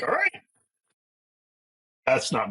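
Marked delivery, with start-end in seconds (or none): 0.0-0.4 very high, comic concession; mock giving in. Dad retelling how he gave in on the zip tie, comically conceding. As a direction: give in with a high-pitched mock shrug.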